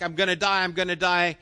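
half-hammed terror at the top of the stairs; mock panic. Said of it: "it's like".

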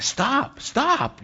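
high, squeaky helpless "stop"; mock pleading scolding. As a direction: imitate a helpless owner scolding her dog. Owner scolding her barking dog.

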